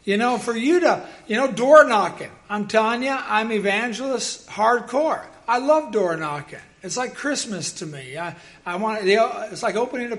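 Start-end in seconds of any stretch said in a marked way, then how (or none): none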